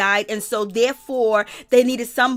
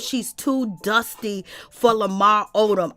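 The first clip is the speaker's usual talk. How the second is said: ordinary talk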